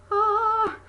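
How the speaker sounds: loud, high gasp of delight; admiring gasp. Retold or imitated. Recalling the wedding dress, high admiring gasp. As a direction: gasp as if awestruck.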